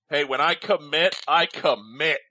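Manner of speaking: ordinary delivery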